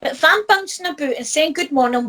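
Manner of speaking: ordinary talk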